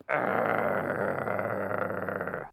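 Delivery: low soft growl; dog growl. A narrator's reading. Puppy growling at the bully. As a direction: show your teeth and growl. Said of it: "showed his teeth and went".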